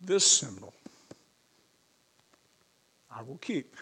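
hushed, halting, with long pauses; holding back real emotion between short phrases; choked up. Real, not acted. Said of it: "Sorry if I'm getting a little choked up".

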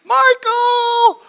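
very high, loud, drawn-out scream; panicked cry. Retold or imitated. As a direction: shriek the name up the cliff for help. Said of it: "she cried out".

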